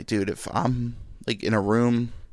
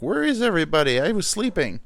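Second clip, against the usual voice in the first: voicing other dog; 8 semitones higher, 4 dB louder than usual. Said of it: "my other dog's like".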